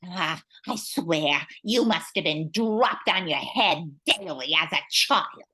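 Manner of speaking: ordinary talk, nothing marked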